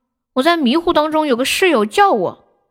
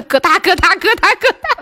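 rising hen-cackle 咯哒; hen clucking; 5 semitones higher than usual. Shown as a demonstration. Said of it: "这马跑着跑着就可以下蛋了".

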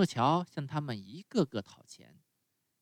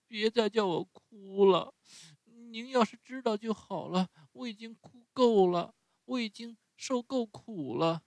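much higher, begging through tears; tearful pleading; 11 semitones higher than usual. A narrator's reading. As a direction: plead with the fairy, near sobbing. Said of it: "哭得泪如泉涌".

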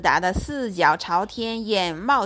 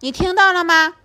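smug; 8 semitones higher, 8 dB louder than usual. Acted. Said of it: "狮子得意地对米努斯说".

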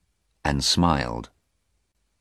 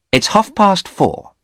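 half past four high and loud; insistent Anna; 5 dB louder than usual. Acted. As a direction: urge it sharply. Anna urging Stephen.

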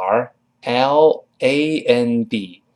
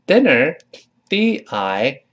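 very slow spelling; 3 semitones higher, much slower than usual. Shown as a demonstration. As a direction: spell "D I" out very slowly. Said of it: "这里短音发哎". (极慢拼读)

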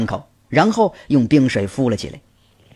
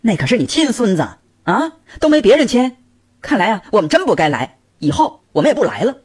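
much higher, blazing angry scolding; high furious rant; 8 semitones higher than usual. A narrator's reading. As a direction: let fly at the mother-in-law. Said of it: "儿媳妇火冒三丈".

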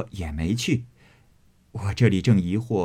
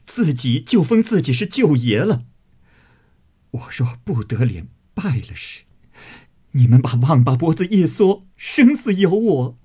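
much higher, louder, tearful rage; crying in fury; 7 semitones higher, 5 dB louder than usual. Acted. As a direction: shout it out sobbing with anger. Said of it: "一面说，一面哭". (又哭又骂，声嘶力竭)

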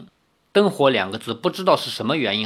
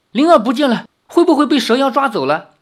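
higher, louder and fast worried; urgent; 10 semitones higher, 6 dB louder, faster than usual. A narrator's reading. Li Xiaoyao fears the snake demon took Ling'er, urgent. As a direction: blurt it out in alarm. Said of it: "李逍遥急道".

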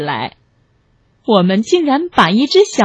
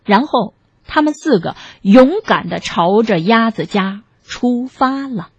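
ordinary delivery, nothing marked

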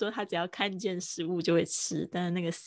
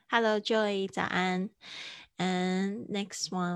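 ordinary delivery

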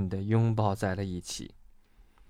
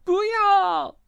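very high, drawn-out scream of no; panicked high-pitched wail; terrified cry; 4 dB louder than usual. A narrator's reading. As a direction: cry out from a nightmare. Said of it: "蹦蹦大叫".

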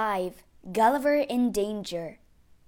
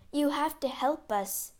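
higher-pitched, earnest plea; pleading; 6 semitones higher than usual. A narrator's reading. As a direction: beg for help. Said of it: "the secretary pleaded".

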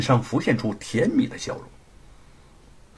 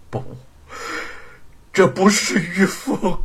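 grieving denial; 7 semitones higher than usual. Acted. Husband denies wife's death.

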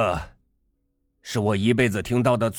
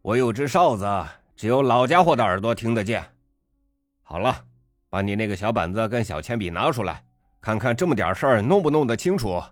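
taunting; 6 semitones higher than usual. A narrator's reading. Father taunts the slow waiter.

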